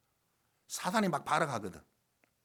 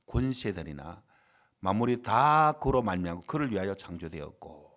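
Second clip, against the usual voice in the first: low, measured scripture recitation; scripture reading; 7 semitones lower than usual. Acted. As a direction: read the verse out solemnly, low in pitch. (낮은 음으로 또박또박 성경 봉독)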